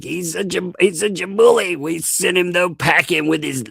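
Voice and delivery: high, loud mocking crowd voice; put-on high-pitched jeering; mock gloating. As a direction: crow it like a sneering bunch of cheerleaders.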